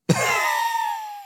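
very high held squeal; one long, thin squeal at the top of the voice. Real, not acted.

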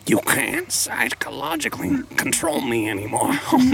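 raspy, unsympathetic cartoon wife impression; Marge Simpson. Wife leaving sad husband, mock unsympathetic. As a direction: rasp it like a fed-up cartoon wife. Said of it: "Marge having no sympathy for him".

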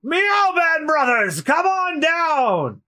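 high, slow call down to the crew. Acted. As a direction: sing out the summons from the deck. Calling the cat pirates down.

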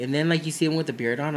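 ordinary talk

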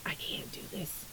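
breathy defeated whisper; hushed, giving up. Retold or imitated. Telling viewers about her scale, whispered defeat. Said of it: "and I'm just like".

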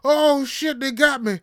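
high, loud cramp wail; yelling. Retold or imitated. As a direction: holler as if seized by cramps. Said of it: "I was yelling".

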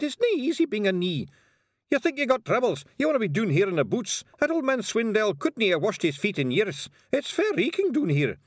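angry little Scottish pixie; cussing Scottish pixie. Acted. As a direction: grumble in broad Scots as a tiny cross pixie. Tiny Feegle cussing in thicket.

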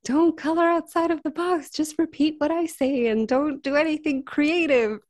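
high, sing-song mocking imitation of rules; mimicked instructor laying down rules; mock strict trainer. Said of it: "that's what they always tell us".

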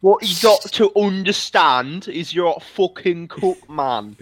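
hammed-up British telling-off; put-on British commentator laying down the law; mock British. British YouTuber parody scolding a rival, mock British. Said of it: "imagine Leafy but British".

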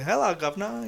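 imitating an English accent. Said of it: "cómo imitan a los ingleses". (imitando acento inglés)

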